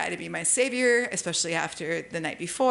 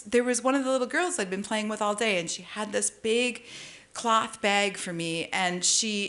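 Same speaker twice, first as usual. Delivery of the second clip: ordinary talk, nothing marked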